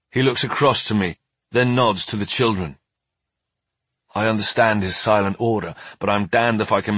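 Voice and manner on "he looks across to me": ordinary delivery, nothing marked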